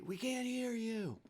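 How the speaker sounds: a hint of a high heckling crowd voice; crowd heckle. Audience complaining they cannot hear the speaker, heckling. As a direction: call out to the stage from the crowd. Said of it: "you can hear people in front of you say".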